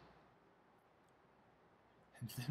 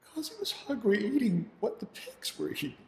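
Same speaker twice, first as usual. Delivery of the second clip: choked, halting grief; pained father, words coming haltingly; grieving; 5 semitones higher, 5 dB quieter than usual. Acted. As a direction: struggle to get the words out. Father grieves over starving son.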